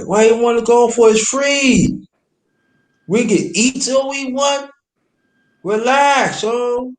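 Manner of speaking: high-pitched incredulous protest; exasperated retold pleading. Man urging his ex to stay at free bar, exasperated.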